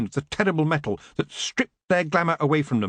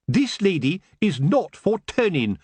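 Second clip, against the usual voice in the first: booming; 7 semitones higher than usual. Acted. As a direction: boom it like a headmistress. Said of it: "Missus Earwig boomed".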